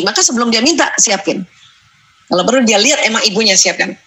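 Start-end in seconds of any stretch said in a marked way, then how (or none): none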